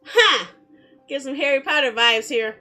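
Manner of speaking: usual speaking voice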